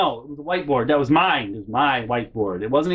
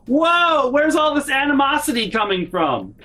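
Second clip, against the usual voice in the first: high-pitched exaggerated bewilderment; mock-shocked reaction; 13 semitones higher, 4 dB louder than usual. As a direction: imitate her acting taken aback, loudly. Mimics her reaction to the outburst.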